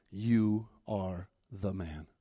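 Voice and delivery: grave quiet verdict. Acted. Prophet delivering God's verdict to David, grave and quiet. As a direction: let each word fall slowly and softly.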